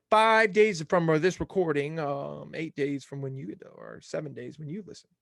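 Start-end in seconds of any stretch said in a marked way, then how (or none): none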